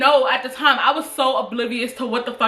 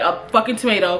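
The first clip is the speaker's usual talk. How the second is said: ordinary talk, nothing marked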